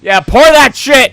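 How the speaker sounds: high, loud cheer; excited shout. Real, not acted. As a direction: yell it with glee. Cheering the drunk actor on.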